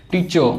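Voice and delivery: "टीचर" said as "टीचो", no R; "टीचर" ending on an open vowel; silent final R. Shown as a demonstration. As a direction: leave the R off the end of "टीचर". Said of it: "रा नहीं लगाते हैं".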